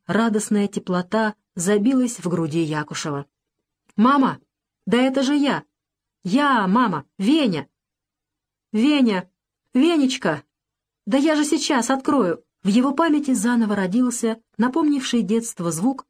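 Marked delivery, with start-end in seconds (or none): 3.9-12.4 excited calls through the door, voice rising; joyful calling. Acted. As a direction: call out gladly to mother behind the door. Сын и мать встречаются у двери. (радостный возглас сына у двери)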